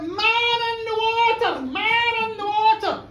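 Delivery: high, slow, sing-song cry for help; shouting alarm. Retold or imitated. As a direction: call it out across the river. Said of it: "she shouted out".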